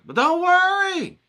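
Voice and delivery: high, loud and drawn out "don't worry"; reassuring cheer. Real, not acted. Art teacher lifting his viewers' spirits, a high, loud, slow cheer. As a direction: sing out the comfort slowly.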